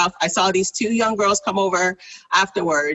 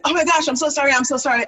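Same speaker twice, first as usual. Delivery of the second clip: flustered apology; 7 semitones higher, much faster than usual. Retold or imitated. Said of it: "she's like".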